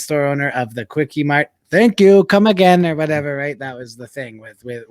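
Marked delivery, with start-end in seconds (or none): 1.7-2.8 imitating Apu's Indian accent, louder and higher; a quick hammed-up Apu catchphrase in an Indian accent; Apu impression. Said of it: "He was the voice of Apu".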